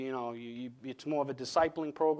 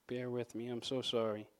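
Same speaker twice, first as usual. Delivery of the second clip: usual speaking voice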